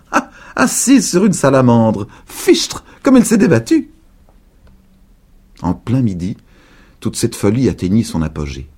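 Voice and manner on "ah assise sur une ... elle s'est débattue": high, louder excited excuse; old woman's excuse. A narrator's reading. Laughing old woman inventing excuse, high loud outburst. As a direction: cover the laugh with a cry. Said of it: "en disant".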